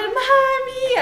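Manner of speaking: put-on a fallen child calling her mother, tearful; crying out for mom. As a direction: cry out Mami like a hurt little girl. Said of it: "así".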